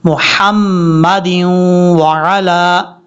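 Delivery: मुहम्मदिन slow with a light ह, अला with a clear ain; correct tajweed. Shown as a demonstration. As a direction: read मुहम्मदिन व अला slowly and cleanly. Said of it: "इस तरीके से।". (मुहम्मदिन व अला को साफ़ और धीरे पढ़ना)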